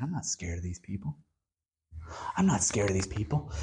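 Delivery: low, quick, unbothered aside; imitated cool confidence, low and quick; unafraid. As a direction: toss it off low, as if unimpressed. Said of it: "he looks around and he's like".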